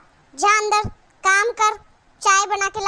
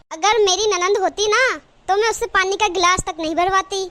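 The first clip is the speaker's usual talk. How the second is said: normal talking voice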